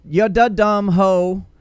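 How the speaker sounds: loud, slow, high angry rant. Pretend. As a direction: rant it like a furious woman calling someone out. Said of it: "I'm gonna give it a shot".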